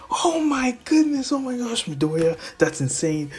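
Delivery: high-pitched awestruck gushing; amazed excitement. Acted. Dad hearing his son destroyed the robot, amazed. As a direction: gush high, then come down a little. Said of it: "he just gets excited".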